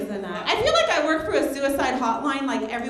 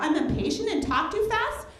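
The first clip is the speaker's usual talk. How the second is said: snappy comeback; 8 semitones higher than usual. Acted. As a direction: throw it back at the boss. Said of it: "I thought about it".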